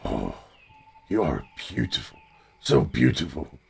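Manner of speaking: low, soft murmur of wonder; tender awestruck character. Acted. Adventurer to the dragon nose to nose, tender awe.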